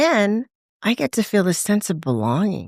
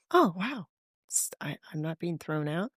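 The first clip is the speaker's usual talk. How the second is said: soft, breathless, unvoiced whisper of relief; awed whisper; 11 dB quieter than usual. Recalling a first AA meeting to a friend, awed whisper. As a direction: whisper it as if you can't believe it. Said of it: "I'm like".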